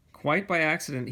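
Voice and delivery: ordinary delivery, nothing marked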